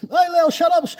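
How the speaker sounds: loud, high joking shout at Leo; loud teasing shout; loud mock insult. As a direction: bark a friendly insult across the gym. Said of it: "those people".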